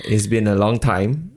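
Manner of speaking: normal talking voice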